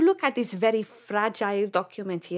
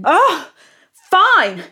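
angry resignation; 6 semitones higher, 9 dB louder than usual. Acted. Giving up angrily.

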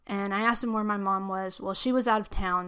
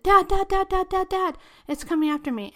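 much higher, louder panicked cries; terrified yell for dad; 10 semitones higher, 4 dB louder than usual. Retold or imitated. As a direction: scream for help as it closes in.

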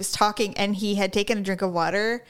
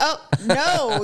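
higher, hammed-up "oh no"; imitated dismay; 5 semitones higher than usual. Coworkers noticing her eye-rolling, mock dismay. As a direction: voice their alarm, pitched up. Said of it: "and they're like".

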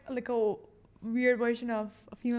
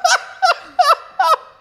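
loud, high-pitched laughing fit; burst of laughter; 12 dB louder than usual. Real, not acted. Shocked amusement at photos online.

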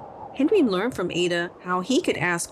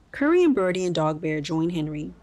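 plain reading voice

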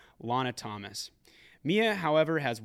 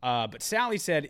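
ordinary delivery

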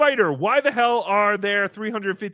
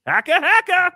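mock dead gasp; 10 semitones higher, 8 dB louder than usual. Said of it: "impression of the current Gilbert Gottfried".

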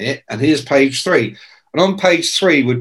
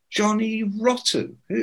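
incredulous echo; 7 semitones higher, 8 dB quieter than usual. Retold or imitated. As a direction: repeat the name as if it can't be real. Reacting to the absurd name.

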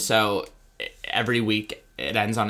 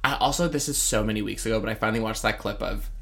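ordinary talk

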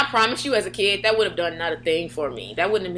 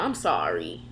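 imitated meek apology; 14 semitones lower, 4 dB quieter, slower than usual. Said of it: "my sisters were more like".

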